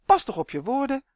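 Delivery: exclaimed warning. A narrator's reading. Malien warns Justin to watch his words, scolding. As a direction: warn them off in exasperation. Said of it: "riep Malien".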